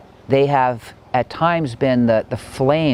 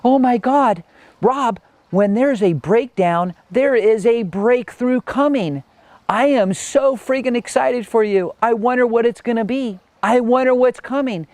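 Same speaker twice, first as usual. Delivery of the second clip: imitating thrilled coach; 11 semitones higher than usual. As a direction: play a life coach gleefully excited for a client. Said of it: "laughing on the phone, saying".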